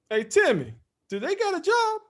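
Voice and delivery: spring the trap with a high sly pitch; dad's punchline. Retold or imitated.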